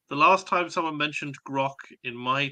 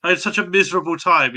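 a whiny mock complaint; mocking whiny quote; 5 semitones higher, 5 dB louder than usual. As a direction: imitate a sulky friend. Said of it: "you said".

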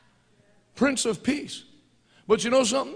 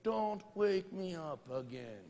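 grumpy warning; 2 semitones lower, 9 dB quieter, much slower than usual. Acted. Grumpy Jesus woken from nap.

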